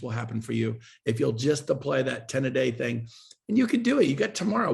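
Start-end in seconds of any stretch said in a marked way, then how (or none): 3.5-4.7 fired-up encouragement. Real, not acted. Guest rallying agents to act, high and fired up. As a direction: pump them up like a coach. Pep talk urging listeners on.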